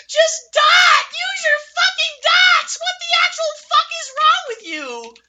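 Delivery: very high screamed fury; raging scream. Real, not acted. Gamer screaming at useless teammates, high raging scream. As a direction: scream at them in pure rage.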